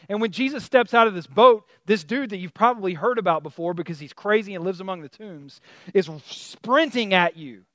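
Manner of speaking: ordinary talk, nothing marked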